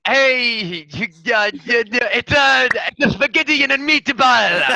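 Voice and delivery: high, loud, hammed-up Italian accent. Player fakes Tank's Italian accent, hammed-up. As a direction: ham up a cartoon Italian cook. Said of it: "Tank's accent?".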